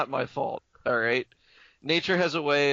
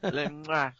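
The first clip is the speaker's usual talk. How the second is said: put-on French accent; 4 dB quieter than usual. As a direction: toss it out in mock French accent. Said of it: "we're done with [name]'s French accent".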